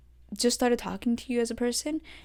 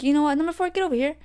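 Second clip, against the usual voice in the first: raised, high-pitched summons; calling out; 7 semitones higher, 5 dB louder, faster than usual. Retold or imitated. Ref summoning number four for a yellow, calling out. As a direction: call the player over, voice climbing.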